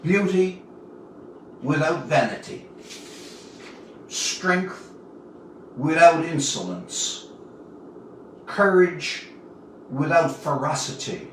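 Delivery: lightly recited like a poem over a grave; reading an epitaph aloud. A narrator's reading. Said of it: "and she read it aloud".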